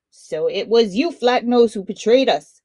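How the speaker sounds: angry accusation. Acted. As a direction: spit out the betrayal. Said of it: "with a gnash of teeth".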